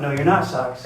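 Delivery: ordinary delivery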